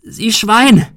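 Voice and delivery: panicked woman bellows at the gunman; roaring. Acted. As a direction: scream the insult at him. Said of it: "brüllte sie".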